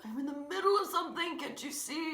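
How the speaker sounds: high-pitched exasperated protest; annoyed big sister. Retold or imitated. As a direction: whine it at a pestering little brother. Annoyed sister brushing off brother.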